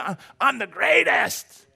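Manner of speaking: drawn-out braggart's brag; boxer's boast. Retold or imitated. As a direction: puff up like a champion boxer.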